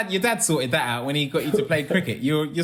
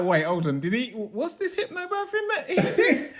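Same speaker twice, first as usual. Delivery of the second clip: high, soft, giggling double take; wait, are you serious, laughing; laughing disbelief; 8 semitones higher, 4 dB quieter than usual. Retold or imitated. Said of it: "I was like".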